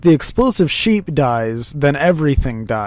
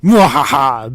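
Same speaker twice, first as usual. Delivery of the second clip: loud mock villain laugh; evil cackle; 7 dB louder than usual.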